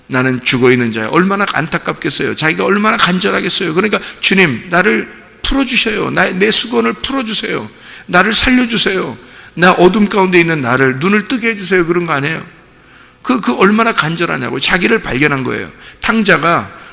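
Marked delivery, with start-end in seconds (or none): 4.2-11.9 pleading prayer. Retold or imitated. Sinner pleading to the Lord.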